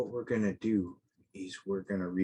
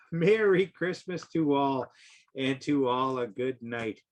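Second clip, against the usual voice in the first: exclaiming; 5 semitones higher, 4 dB louder than usual. A narrator's reading. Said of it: "I heard him exclaim".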